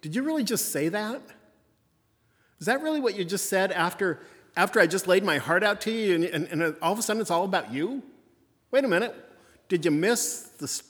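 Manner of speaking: playing Jesus, a touch exasperated; preacher voicing Jesus' mild disbelief at the disciples; incredulous Jesus. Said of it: "kinda shaking his head going".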